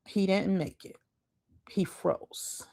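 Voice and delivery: hushed and grave. Real, not acted. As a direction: drop to a grim whisper. Grim reveal, drops voice.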